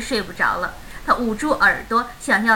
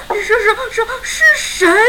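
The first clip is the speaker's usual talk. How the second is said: high, loud, stammering fright; trembling voice; 10 semitones higher, 6 dB louder than usual. A narrator's reading. Startled dad asks who is knocking, high and trembling. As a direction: stutter it out in a shaking voice. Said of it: "声音有些颤抖".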